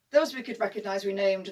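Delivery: ordinary delivery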